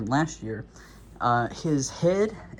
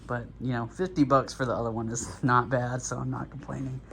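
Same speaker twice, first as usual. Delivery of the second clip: usual speaking voice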